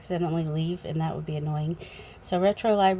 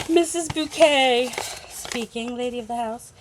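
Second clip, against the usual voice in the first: posh lady of the house; 4 semitones higher than usual. Pretend. Vlogger punning on bouquet, lightly hammed snooty lady. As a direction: put on a snooty hostess voice for a moment.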